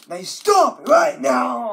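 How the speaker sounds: loud high order to stop right now; scolding shout. Acted. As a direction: snap the command at the screen. Ordering the computer.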